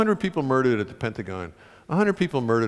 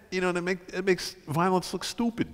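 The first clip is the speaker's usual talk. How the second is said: ordinary talk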